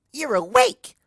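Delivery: very high, loud, bouncy pony voice; bubbly Pinkie. Acted. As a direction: burst with glee at a friend waking. Pinkie greets waking Twilight.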